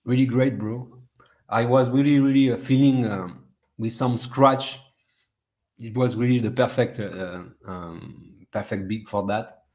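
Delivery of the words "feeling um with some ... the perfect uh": ordinary talk, nothing marked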